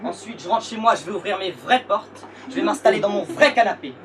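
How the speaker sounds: from firm to loud defiant shout; building to a shout. Acted. Defiant closing rant.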